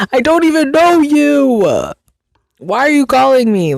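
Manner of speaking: high, loud mock wail; hammed-up indignant complaint; exasperated outburst. Pestered callee venting at unknown robocallers, exasperated.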